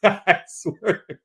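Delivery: slow words through real laughter; cracking up while swearing to God; laughing. Real, not acted. Laughing at Tony's joke, slowly getting the words out. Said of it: "Sorry, that was funny".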